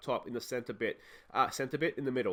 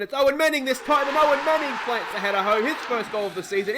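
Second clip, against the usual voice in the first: loud, high commentator's shout; excited goal call; 9 semitones higher, 8 dB louder than usual. Real, not acted. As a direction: burst out as the header goes in, then ease off.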